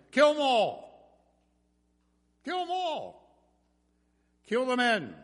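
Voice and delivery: high, loud battle command; shouted army order. Retold or imitated. Pharaoh ordering his army after the Israelites, shouted command. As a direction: bellow the order to the troops. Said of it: "Whether he said to the army".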